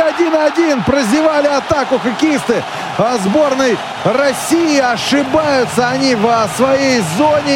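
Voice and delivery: high, agitated call of the equaliser; excited goal call. Real, not acted. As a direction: raise the voice high in alarm. Equaliser conceded, commentator reacts. (взволнованный комментарий гола)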